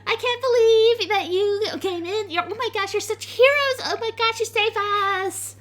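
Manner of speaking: very high, gushing squeal; giddy high-pitched tiefling voice; bouncing, gushing girl. Acted. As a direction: fangirl over your heroes. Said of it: "starts bouncing on her feet".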